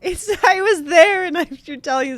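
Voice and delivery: tearful cry with a laugh caught in it. Real, not acted. Wife overcome with emotion at the retold story, crying. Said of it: "you gotta cut this crying shit off".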